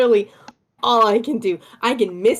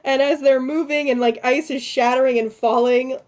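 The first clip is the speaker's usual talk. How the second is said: ordinary talk, nothing marked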